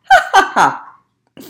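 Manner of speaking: loud, high hearty ha ha ha; roaring laugh. Acted. Lion roaring with laughter instead of roaring.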